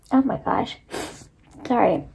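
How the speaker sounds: soft, stuffed-up voice after crying; stuffy nose after crying. Real, not acted. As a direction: speak through a blocked nose. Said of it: "my nose is a little stuffed".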